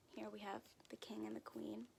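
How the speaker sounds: hushed, unvoiced aside; whispered aside. Real, not acted. Reader pointing at the picture, whispering. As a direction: whisper it to the listener.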